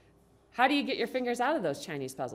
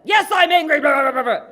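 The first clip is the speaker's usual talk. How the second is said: high, very loud angry rant; pissed-off teenager; 7 semitones higher, 14 dB louder than usual. Acted. Teenage daughter to validating parent, acted angry yelling. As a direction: yell back, dissolving into furious babble. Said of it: "when she's all pissed off".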